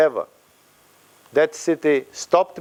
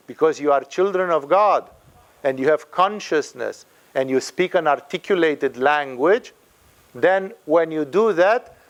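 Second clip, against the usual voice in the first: normal talking voice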